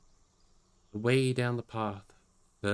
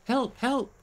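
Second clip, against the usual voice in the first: high, loud desperate calls for help; a frightened hobbit yelling for help; crying for help; 12 semitones higher, 6 dB louder, slower than usual. Acted. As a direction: shout into the wind, panicked and lost.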